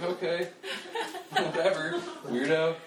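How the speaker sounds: high, laughing dismissive lady; mimicking the lady. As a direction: toss it off like she's weirded out. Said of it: "She's like".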